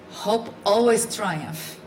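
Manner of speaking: normal talking voice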